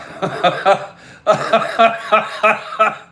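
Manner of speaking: high, jittery burst of laughter; nervous laugh. Real, not acted. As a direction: laugh out of sheer nerves. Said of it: "it's a nervous laugh".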